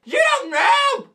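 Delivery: high, loud, drawn-out mock protest; outraged shout. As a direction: yell it slowly in hammed-up outrage. Outraged reply to being called liar.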